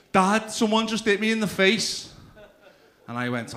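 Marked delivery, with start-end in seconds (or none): 0.1-2.1 imitated kid's high, loud wail; complaining child. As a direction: tell on someone like an upset little boy. Acts out his son complaining.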